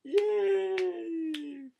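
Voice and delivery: very high drawn-out squeal; hammed-up falsetto yay; gleeful cheer. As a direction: cheer like a delighted cartoon critter clapping. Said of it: "doing, like, this clapping thing".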